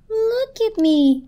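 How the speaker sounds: very high, slow, pleading wail; crying blanket. A narrator's reading. Blanket showing its tear, high distraught cry. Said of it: "cried the blanket".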